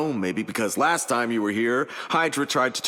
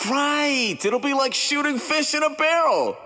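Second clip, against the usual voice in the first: eager. Acted. Eager quip before awkward backtrack.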